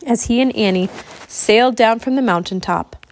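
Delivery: ordinary delivery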